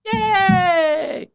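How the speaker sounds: a sarcastic little celebration; mock cheer. Celebrating his own diagnosis with a high mock cheer.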